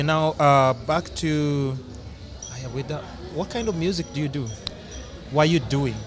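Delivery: usual speaking voice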